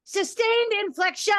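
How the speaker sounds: "Sustained inflection" held on one high pitch. Retold or imitated. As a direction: keep the last syllables level and high. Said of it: "say it with a sustained inflection".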